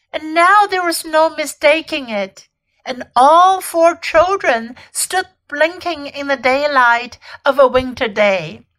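ordinary delivery

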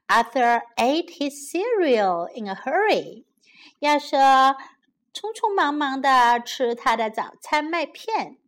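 usual speaking voice

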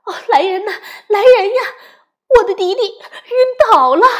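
high, loud panicked cry for help; alarm shout. Acted. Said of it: "妈妈大喊".

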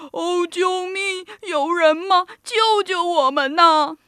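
high, slow, loud cries for help; hammed-up bawling of terrified animals; wailing in tears. A narrator's reading. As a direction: wail desperately, dragging out the words. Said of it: "动物们嚎啕大哭起来".

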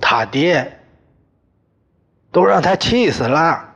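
high-pitched bitter retort; father-in-law shouting at the mother; shouting back. Acted. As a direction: throw it back at her. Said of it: "冲着我娘喊".

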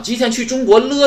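high, loud 吉田去中国了 with 了 stretched out; stress on 了. Shown as a demonstration. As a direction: lean hard on the final 了. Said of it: "它不过去了吗".

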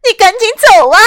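loud, high, desperate cry; tearful shout. Acted. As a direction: shout through tears for her to leave. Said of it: "艾莎颤抖着流出了眼泪".

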